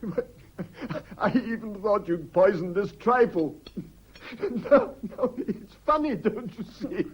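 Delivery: high, loud giggling through the words; nervous laughter. Acted. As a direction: laugh off a dark fear, half hysterical. Nervous laughter over poisoned trifle.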